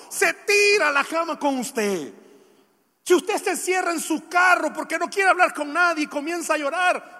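high, loud pulpit voice; fervent preacher in full flow; impassioned preaching. Real, not acted. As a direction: challenge the congregation forcefully. Preaches with force.

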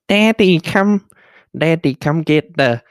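high, loud mock toddler voice; baby voice. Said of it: "talk in a baby voice".

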